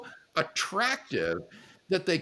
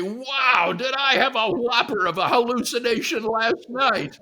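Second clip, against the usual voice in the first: higher, louder, exaggerated astonishment; mock amazement; 7 semitones higher, 6 dB louder than usual. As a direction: play up the opponent's incredulous wonder. Imagines opponent's astonished reaction.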